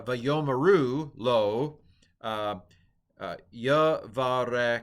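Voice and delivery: Hebrew read out slowly, word by word; slow Hebrew recitation; slow careful pronunciation. Shown as a demonstration. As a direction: sound out Vayomaru lo and yavarek deliberately. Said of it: "so let me pronounce the rest of it".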